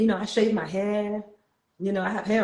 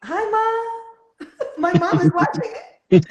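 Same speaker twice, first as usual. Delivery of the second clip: delighted surprise; 10 semitones higher, 5 dB louder, slower than usual. Real, not acted. Spots mom watching live.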